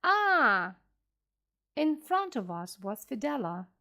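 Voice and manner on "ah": sharp loud startled "Ah!"; sudden cry. A narrator's reading. Captain crying out at the sight of the dead man, sudden shout. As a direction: cry out in shock. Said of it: "Captain Ahab suddenly cried".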